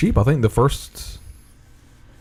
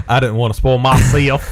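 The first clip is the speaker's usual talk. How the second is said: put-on southern accent; 4 semitones higher than usual. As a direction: say it like a good old Southern boy. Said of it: "Got my southern accent coming out right here".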